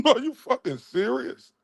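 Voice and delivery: laughing disbelief. Real, not acted. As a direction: laugh through "Are you fucking serious?" in disbelief.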